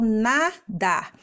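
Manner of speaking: "nadar" in two slow beats; two stretched, separate syllables in "nadar". Shown as a demonstration. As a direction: split "nadar" as na... dar. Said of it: "a gente abre a boca duas vezes".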